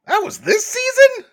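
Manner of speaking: high, loud squeal of disbelief on season; shocked disbelief voice. Shown as a demonstration. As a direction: squeak it out, as if you can't believe it.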